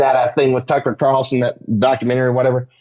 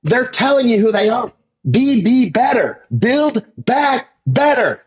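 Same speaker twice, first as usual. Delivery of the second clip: emphatic warning; 8 semitones higher than usual. Retold or imitated. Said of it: "I'm like".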